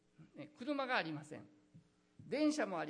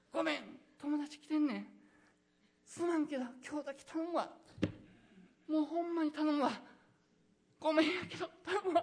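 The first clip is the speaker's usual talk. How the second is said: high, loud Kansai begging, laughing at the end; 大阪弁で頼み込む; pleading in Osaka dialect; 8 semitones higher, 6 dB louder than usual. Pretend. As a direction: beg a friend for bread in Osaka dialect. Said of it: "大阪弁に行ってます".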